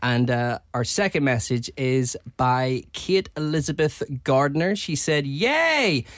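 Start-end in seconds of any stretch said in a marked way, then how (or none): 5.3-6.0 high, drawn-out cheer; excited yay. Retold or imitated. Reading a fan's message to the hosts, excited yay. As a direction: read it out as a gleeful cheer. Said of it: "She started with yay".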